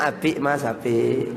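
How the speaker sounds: low, courteous Javanese question; polite Javanese speaker. Acted. Javanese speaker still asking Mas at death's door, politely. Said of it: "Jawa kalau ngomong luar biasa santun".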